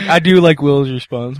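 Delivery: ordinary talk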